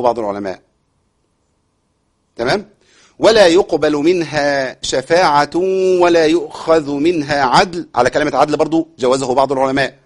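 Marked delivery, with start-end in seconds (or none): 3.2-7.9 slow, measured chanting of the verse; Quran recitation. Shown as a demonstration. Teacher recites the verse for students, slow chant. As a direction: recite it calmly with long vowels.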